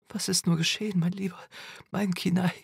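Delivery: high tearful lament; sobbing. A narrator's reading. Mother grieving her changed son, sobbing. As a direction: plead tearfully, pitched well up. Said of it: "schluchzte ich".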